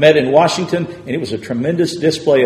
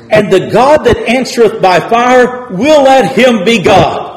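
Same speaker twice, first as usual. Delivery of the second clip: raised, ringing preacher voice building the challenge; preaching; 7 semitones higher, 5 dB louder than usual. Acted. Preacher retelling Elijah's challenge to Baal's prophets, ringing sermon voice. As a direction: proclaim it from the pulpit.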